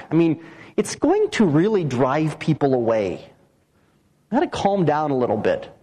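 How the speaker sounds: low-pitched, reasonable-sounding doubter; mock sceptical objector. Preacher voicing Christians who find Paul too radical, low sceptical objection. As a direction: talk them down in a low voice.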